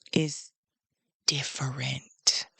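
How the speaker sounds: breaking into a whisper; barely voiced, slow and hushed. Real, not acted. Grieving pause, whispered word.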